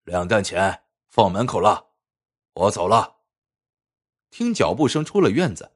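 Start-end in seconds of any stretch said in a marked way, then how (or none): 0.0-3.2 booming muffled voice. Acted. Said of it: "那大汉瓮声瓮气地说".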